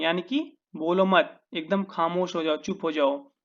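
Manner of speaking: ordinary talk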